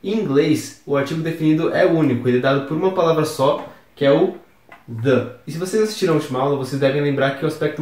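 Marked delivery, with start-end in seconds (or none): none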